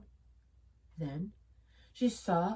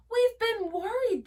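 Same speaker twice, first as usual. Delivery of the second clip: relieved cry; 13 semitones higher, 4 dB louder than usual. A narrator's reading. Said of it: "they cried".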